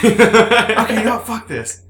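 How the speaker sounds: mock fed-up caller, high and loud at first; exasperated outburst. Caller quitting on tech service, mock exasperation. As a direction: burst out high, imitating someone giving up.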